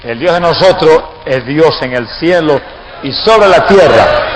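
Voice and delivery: normal talking voice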